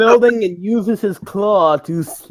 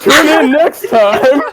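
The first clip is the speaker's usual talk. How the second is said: mock TV announcer; 5 semitones higher, 4 dB louder, slower than usual. Parodies a TV show outro.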